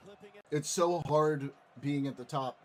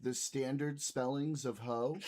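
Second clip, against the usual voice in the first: mock serious; 7 dB quieter than usual. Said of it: "I'm taking it so seriously".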